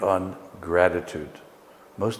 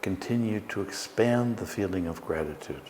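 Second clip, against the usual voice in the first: guided meditation; 4 dB quieter than usual. Acted. Leads a guided breathing meditation.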